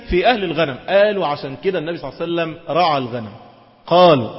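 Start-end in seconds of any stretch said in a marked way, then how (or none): none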